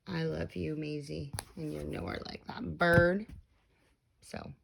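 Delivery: soft, low and loving; tender goodnight. Real, not acted. Parent telling daughter Maisie they love her, soft.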